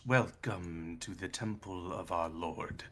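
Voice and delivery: low, soft welcome; hushed, ominous greeting; eerie cultist figure. Acted. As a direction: intone it as a solemn temple guide. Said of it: "he just goes".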